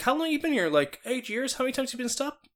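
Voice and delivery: high, astonished questions to a friend; asking a friend how often he's been stopped, amazed; incredulous quizzing. Retold or imitated. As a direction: pitch way up, disbelieving. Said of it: "I look at him like".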